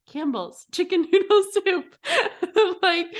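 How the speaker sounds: hammed-up product pitch through laughter; mock ad read. Contestant pitching soup to the camera, mock ad read.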